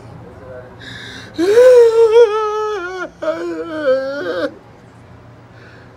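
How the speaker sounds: high hammed-up sob, loud; mock sobbing wail. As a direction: fake wailing after inviting the family to cry. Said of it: "Cry with me".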